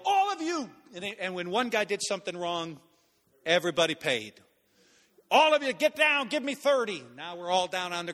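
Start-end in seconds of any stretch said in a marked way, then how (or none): 0.1-0.7 high-pitched retold command shout; sergeant's yell. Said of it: "then he yelled". 5.3-7.0 high, loud mock drill command; sergeant's yelled order. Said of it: "then he yelled".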